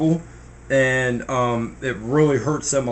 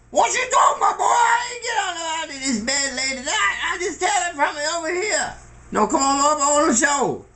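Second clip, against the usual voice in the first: put-on high, shouting old Papa, half laughing; old man. Papa shouting back from his bed, laughing.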